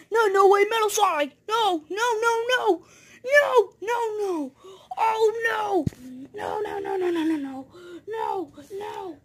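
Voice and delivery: high, frantic repeated no; panicked. Acted. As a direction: beg in rising panic. Sonic panics at Metal Sonic.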